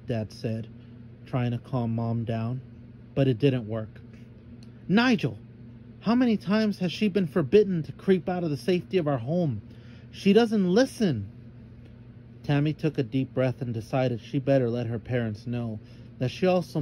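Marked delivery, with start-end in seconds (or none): 4.9-11.3 1 exasperated mother. A narrator's reading. Upset mother complains to father.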